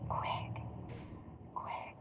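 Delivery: hushed whispered repeat of Quick. Shown as a demonstration. Telling listeners how fast hens lay, whispered. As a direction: breathe the word Quick. with no voice. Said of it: "repeated as whisper".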